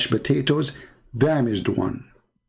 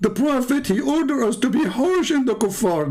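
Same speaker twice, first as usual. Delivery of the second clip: high, mocking caricature of a zealot; mocking impression; 11 semitones higher than usual. Mocking an opponent who preaches harshness, high caricature. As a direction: squeak it out like a ranting zealot.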